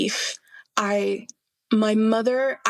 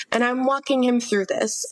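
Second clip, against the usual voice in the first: normal talking voice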